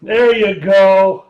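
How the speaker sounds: loud, upbeat cheering; excited cheering. Real, not acted. Co-host cheers the bubbles on for the viewers, loud. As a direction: hype the bubbles up.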